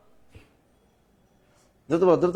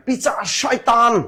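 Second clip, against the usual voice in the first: high, slow mock scolding of a boyfriend; mock breakup insult; 7 semitones higher, slower than usual. Teacher imitates a girl cursing her ex after a breakup. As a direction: hurl it like a jilted girlfriend. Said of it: "যখন breakup ঘটে কয়".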